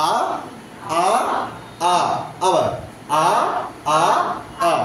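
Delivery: each "আ" a separate sustained vowel; teacher modelling three central "আ" vowels; central vowel drill. Shown as a demonstration. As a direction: sound out "আ, আ, আ" with gaps between. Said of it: "এবার আমরা উচ্চারণ করি তিনটে central vowel".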